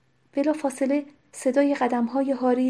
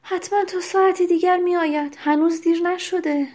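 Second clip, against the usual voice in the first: higher, brushing him off; impatient; 6 semitones higher, 4 dB louder than usual. A narrator's reading. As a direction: dismiss his fuss impatiently.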